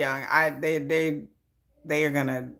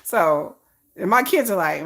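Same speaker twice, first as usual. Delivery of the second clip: ordinary delivery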